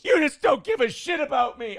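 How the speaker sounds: high-pitched imitation of a wounded complaint; mock upset outburst. April accusing him of not caring, high mock outburst. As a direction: wail the accusation, hammed-up and high.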